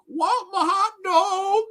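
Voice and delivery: slow, high, wailing mock ghost voice. Ghost haunting the man with a hot dog, high wailing mock voice. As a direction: drag it out like a moaning ghost.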